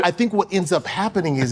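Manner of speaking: ordinary talk, nothing marked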